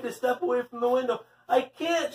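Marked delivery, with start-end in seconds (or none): 1.5-2.1 high, strained-effort protest; groaning. A narrator's reading. Leslie hauls Paul by her pigtails, groaning. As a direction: groan it while pulling a heavy load. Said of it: "she groaned".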